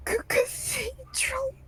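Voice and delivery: high-pitched, stammering through sobs; sobbing mutter. Acted. Grieving widow answers where to bring her husband's body, sobbing. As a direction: choke out the word in a high, tearful stutter. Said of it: "through her sobs, she just, like, mutters out the one word".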